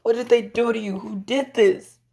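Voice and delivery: put-on high-pitched, distressed little girl; distraught child. Girl runs to her hurt papa, distraught. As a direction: cry out in acted alarm, high. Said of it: "she's crying on him".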